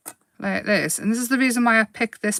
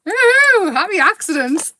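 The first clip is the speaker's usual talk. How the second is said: high, delighted squeal of ooh; thrilled at the surprise; 9 semitones higher, 5 dB louder than usual. Real, not acted. Delighted at paint result.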